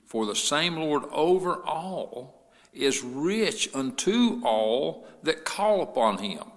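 slow, measured Bible reading; scripture reading. Acted. Preacher reading Romans to the congregation, slow and measured. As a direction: lay each word of the verse down slowly. Reads scripture aloud slowly.

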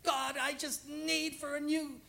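high, slow mock plea; begging prayer. Believer begging God before watching angels, mock pleading.

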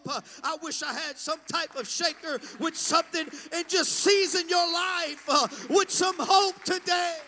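a preacher pleading with the church, loud and high; full-force preaching. Acted. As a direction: drive the hope home to the church.

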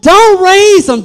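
Satan begging Jesus not to raise Lazarus, panicked; Satan pleading. Retold or imitated. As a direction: beg Jesus frantically, rising to a yell. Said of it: "I'm sure Satan was like".

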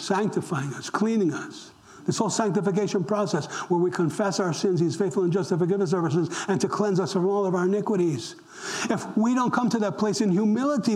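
ordinary delivery

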